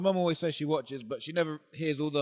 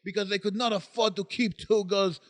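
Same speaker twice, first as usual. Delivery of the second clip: raised-pitch storyteller with a fake Ukrainian accent; put-on Ukrainian accent; 3 semitones higher than usual. Taking a turn reading the ghost story, mock Ukrainian accent. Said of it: "I thought you made me do the accent".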